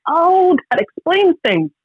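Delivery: high, bright oh of sudden understanding; retold aha moment; mock realization. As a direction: pitch up on oh like it all clicks. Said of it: "And I was like".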